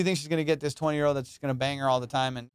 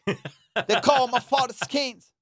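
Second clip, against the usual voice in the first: put-on much higher, louder Jamaican accent, slowing at the end; 10 semitones higher, 5 dB louder, slower than usual. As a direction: boast about his dad in a put-on Jamaican voice. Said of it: "He's like".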